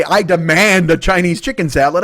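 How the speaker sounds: loud, slow, high mock demand; hammed-up pompous customer demand. Diner parodying how he didn't order, mock demanding. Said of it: "I mean, I didn't say".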